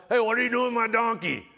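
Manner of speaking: a lightly voiced owner firing off the question; donkey owner. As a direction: play the startled owner catching them. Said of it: "Sure enough".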